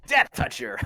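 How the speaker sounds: high, loud mock battle cry; hammed-up battle cry. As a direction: yell it like a cartoon hero. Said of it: "It's kinda like".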